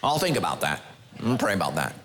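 low, lazy mock voice of a dodging believer. Preacher mocking Christians who stall obedience, in a low lazy voice. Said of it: "that's what Christians do".

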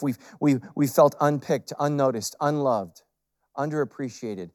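usual speaking voice

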